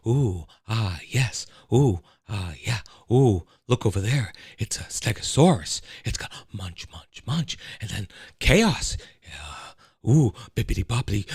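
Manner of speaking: halting actor impression full of oohs and ahs; musing, stuttery movie-star impression, dropping soft; Jeff Goldblum. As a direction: murmur in little stops and starts. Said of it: "Jeff Goldblum needs more of a softer".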